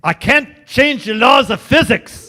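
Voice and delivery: exclaimed starship-engineer Scottish burr; hammed-up, drawn-out Scottish accent; Scotty. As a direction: protest loudly in broad mock Scottish. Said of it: "that's Scotty from Star Trek".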